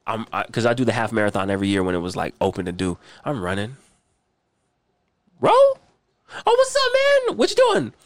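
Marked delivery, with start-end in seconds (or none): 5.4-7.9 high, loud mock Ro meeting him on a run; imitating Ro. Imitating Ro bumping into him on a run, high and loud. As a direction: put on a high goofy voice as Ro.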